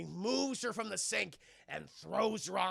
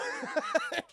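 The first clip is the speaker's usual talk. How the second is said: high-pitched cackling laugh; real laugh; 3 dB louder than usual. Real, not acted. Storyteller cracking up after his protest, high laugh. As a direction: burst out laughing.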